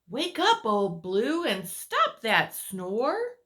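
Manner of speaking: sing-song call to wake him up. Acted. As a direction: rouse the sleeper with a cheery shout. Character calls sleeper awake.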